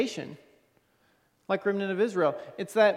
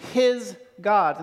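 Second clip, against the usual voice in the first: his God with his punched louder; his said loud and firm before God; stress on his; 5 dB louder than usual. Shown as a demonstration. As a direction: lean hard on the word his. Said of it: "I'll emphasize the word his there".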